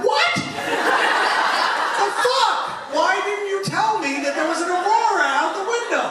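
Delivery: loud, very high indignant outburst; mock outrage. Passenger learns the flight attendant hid the Aurora, mock outrage. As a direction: retell it as a furious, incredulous shriek. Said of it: "I was so mad".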